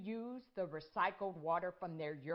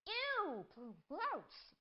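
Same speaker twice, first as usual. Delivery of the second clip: disgusted; 9 semitones higher than usual. Real, not acted. Disgust at burps.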